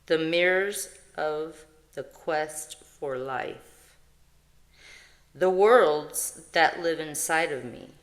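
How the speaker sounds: slow, solemn reading of a spiritual verse; reading card text. Acted. Vlogger reading her oracle card to viewers, solemn and slow. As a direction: intone the card like a prayer.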